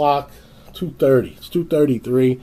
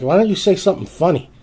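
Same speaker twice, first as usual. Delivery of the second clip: higher, louder, rising challenge; exasperated higher shout; angry demand; 8 semitones higher, 4 dB louder, faster than usual. Retold or imitated. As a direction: snap at them to be funny. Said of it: "finally I was like".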